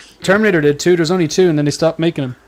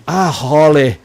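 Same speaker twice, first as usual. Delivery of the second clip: lightly put-on Irish accent, Gerard Butler style; a weak Irish lilt on the name; bad Irish accent; much slower than usual. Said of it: "He's got a really bad Irish accent".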